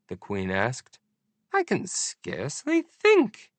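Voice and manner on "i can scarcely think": very high slow royal voice; Queen's voice. A narrator's reading. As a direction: complain as a fussy queen. Queen complaining.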